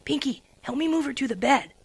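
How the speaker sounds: plain reading voice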